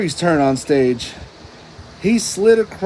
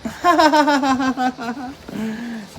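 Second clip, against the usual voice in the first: high giggling cackle; cartoon laugh; 7 semitones higher than usual. A narrator's reading. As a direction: let out a silly sponge laugh. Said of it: "SpongeBob laughed".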